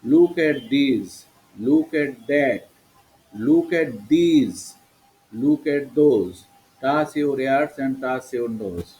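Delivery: very slow, word-by-word classroom chant; drawn out for pupils to repeat; listen-and-say model. Shown as a demonstration. (শেখানোর জন্য খুব ধীরে টেনে টেনে)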